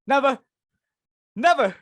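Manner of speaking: vowing never to throw another party; emphatic never. Acted. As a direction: slam the door on the idea with each Never. Hostess swearing off entertaining.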